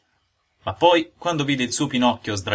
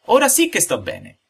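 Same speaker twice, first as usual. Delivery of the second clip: high, loud, delighted sigh; patting his full belly with glee; high happy puppet crowing; gloating; 6 dB louder than usual. A narrator's reading. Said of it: "disse gongolando".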